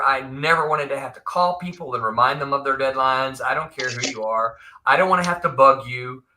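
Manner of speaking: usual speaking voice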